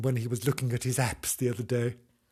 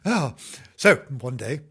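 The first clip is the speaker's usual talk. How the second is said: plain reading voice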